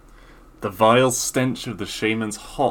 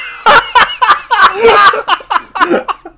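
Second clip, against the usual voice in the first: shrieking high laugh; loud laughter; 12 dB louder than usual. Real, not acted. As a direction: burst out laughing loudly. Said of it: "I was trying to go for a sultry voice".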